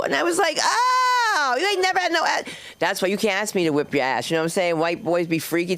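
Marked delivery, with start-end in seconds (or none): 0.6-2.4 long, very high exclamation of disbelief; mock outcry. Comic answering friend who said mother beat her, mock high outcry. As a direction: cry out high in hammed-up indignation.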